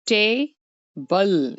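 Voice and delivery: टेबल drawn out slowly, split into its two syllables; टेबल stretched slow for the class; slow syllable demo. Shown as a demonstration. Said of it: "ये दो syllable हैं".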